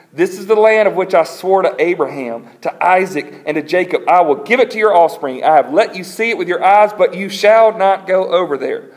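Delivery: emphatic, high-energy scripture reading; divine pronouncement. Acted. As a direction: proclaim it forcefully as God. Reads God's pronouncement to Moses.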